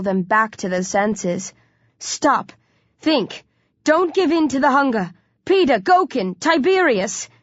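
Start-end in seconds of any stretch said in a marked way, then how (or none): 2.0-7.3 raised, high-pitched appeal; urgent plea. A narrator's reading. Hero to hunger-crazed friends, urgently pleading. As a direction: beg them to come to their senses.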